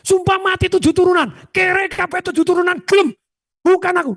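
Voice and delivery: high mock oath of innocence; retold oath. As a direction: swear on seven generations in a high voice. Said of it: "Bapak, naon?".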